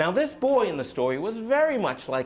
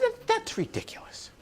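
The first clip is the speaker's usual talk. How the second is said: scoffing wife; 5 dB quieter than usual. Acted. Wife scoffing in a fairy tale.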